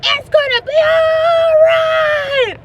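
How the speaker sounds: high, loud, slow preacher holler; TV preacher shouting. Retold or imitated. TV preacher encouraging viewers, loud slow sermon shout. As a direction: preach it at full volume. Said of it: "favorite T V preacher ... who goes".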